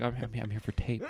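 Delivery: soft, shaky, nervous stammer; quiet and quivery. Retold or imitated. Telling a friend how he froze up, quiet quivery stammer. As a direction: mumble it timidly with a nervous laugh. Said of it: "I said it all quiet and quivery".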